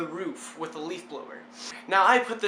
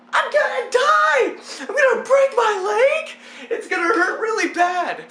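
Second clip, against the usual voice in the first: mock panicked shouting, very high and loud; hammed-up freak-out; 8 dB louder than usual. Hanging from the gutter, freaking out, hammed-up panic. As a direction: shriek in exaggerated terror, as if about to fall. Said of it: "I'm freaking out like".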